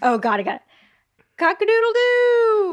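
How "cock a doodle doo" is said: high, slow rooster crow. Pretend. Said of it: "doing a rooster sound".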